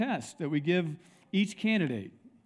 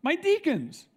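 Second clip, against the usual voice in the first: incredulous retelling; 7 semitones higher than usual. Retold or imitated. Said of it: "He says".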